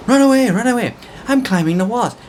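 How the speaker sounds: flee in mock terror; mock panic. Vlogger pretending to flee the angry nanny in mock panic.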